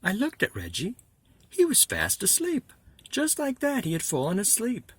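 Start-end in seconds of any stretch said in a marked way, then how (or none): 1.5-4.9 high, loud surprise; surprised. Acted. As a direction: say it with sudden disbelief. Surprise Reggie asleep.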